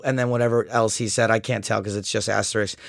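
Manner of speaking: ordinary talk, nothing marked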